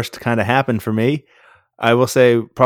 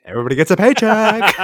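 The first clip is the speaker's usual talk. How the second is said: fast, higher mock announcement; Oprah-style giveaway; 9 semitones higher, 4 dB louder, faster than usual. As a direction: rattle it off like a giveaway host. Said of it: "Like being at the Oprah Winfrey Show".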